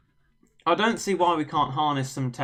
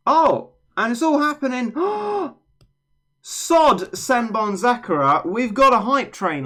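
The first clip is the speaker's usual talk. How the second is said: loud, high, thrilled; excited shout; 9 semitones higher, 6 dB louder than usual. Real, not acted. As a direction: call out the hype train. Hype train excitement.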